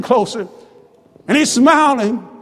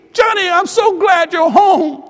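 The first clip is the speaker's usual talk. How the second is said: high, exultant preacher's cry; overjoyed father; 5 semitones higher than usual. Acted. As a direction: welcome the child home with full fervour. Father rejoices at son's return.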